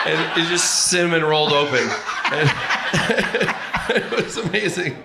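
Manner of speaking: high, loud words through laughter; laughing. Real, not acted. Telling the punchline, laughing through the words.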